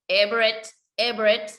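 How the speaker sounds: a naughty little frog croaking backwards. Acted. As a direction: croak Everett, ribbit said backwards. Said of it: "굴개굴개 이렇게 거꾸로 울잖아".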